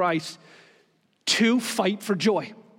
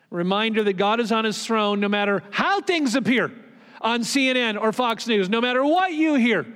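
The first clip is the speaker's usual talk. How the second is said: raised, higher-pitched sermon voice; impassioned preaching; 5 semitones higher than usual. Real, not acted. Pastor reassuring the church that God is on his throne, impassioned preaching. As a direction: preach it with mounting urgency. Preacher builds on God's sovereignty.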